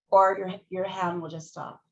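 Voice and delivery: normal talking voice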